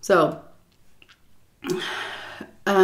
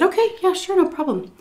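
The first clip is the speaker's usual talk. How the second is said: chirpy, eager-to-please, pitched much higher; agreeable reply; 12 semitones higher than usual. Retold or imitated. Caregiver agreeing as the daughter-in-law leaves, chirpy. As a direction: sweetly agree to anything. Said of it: "I said".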